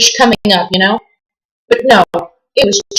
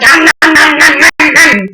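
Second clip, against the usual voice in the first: high, louder mock chomping noises; chomping garfish; 7 semitones higher, 3 dB louder, slower than usual. Imitating a mean garfish eating, hammed-up nom nom. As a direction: play the fish snapping its jaws. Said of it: "And they're just like".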